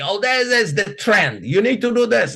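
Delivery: lightly voiced guru selling a trend; mock hype speaker.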